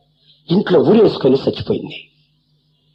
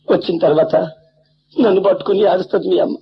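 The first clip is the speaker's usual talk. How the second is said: ordinary talk, nothing marked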